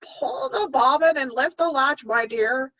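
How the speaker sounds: high put-on old lady voice; wolf imitating granny. Wolf disguised as Granny, high put-on old voice. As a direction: coax her in like a fake grandma. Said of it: "imitating Granny's voice as much as possible".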